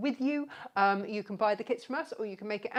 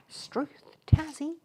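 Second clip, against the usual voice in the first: higher-pitched, slow mock Australian accent; bad Australian accent; 5 semitones higher than usual. Host exclaiming to Tassie, bad Australian accent. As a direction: put on a badly faked Aussie exclamation. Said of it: "my very bad Australian accent there".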